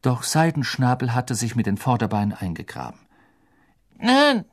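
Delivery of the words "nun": put-on high, thin voice of an old official; reedy old committee man, impatient; frail old man wanting it over. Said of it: "die dünne Stimme des alten Ausschussmitglieds".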